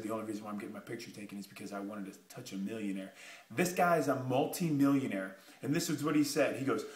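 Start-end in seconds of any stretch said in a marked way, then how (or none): 0.0-3.1 hushed, fast whisper into his ear. Retold or imitated. To the millionaire beside him on stage, a quick whisper. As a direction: lean in and whisper it quickly, confiding. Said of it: "I whispered in his ear".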